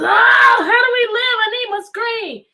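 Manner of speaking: high, loud mock wail of a screenless kid; mock whining kid. Teacher imitating a child panicking without screens, loud and high. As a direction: ham up a kid who can't live without screens. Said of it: "everyone else that says".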